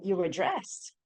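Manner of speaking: ordinary talk, nothing marked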